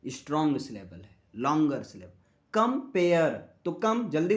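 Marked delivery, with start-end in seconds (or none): 2.5-3.5 "compare" slowly in two beats, कम quick, pair long; syllable split. Shown as a demonstration. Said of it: "कितने झटके में बोल रहे हैं".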